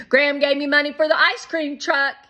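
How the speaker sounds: put-on high, loud and fast kid excitement; excited little boy. A narrator's reading. Shake telling Shermie about the ice cream money, excited. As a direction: rush it out, thrilled.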